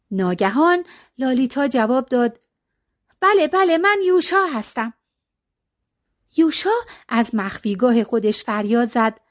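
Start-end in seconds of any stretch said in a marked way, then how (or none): 3.2-4.9 put-on hurried eager girl, higher; girl lying. Lalita to the monk, eager higher girl voice. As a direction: claim the name quickly, a bit nervous.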